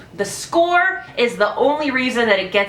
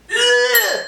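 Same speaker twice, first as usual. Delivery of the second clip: high, loud fed-up ugh; exasperated groan; 13 semitones higher, 3 dB louder than usual. Real, not acted. Reviewer groaning in disgust at the doctors, high fed-up ugh. Exasperated reaction to movie scene.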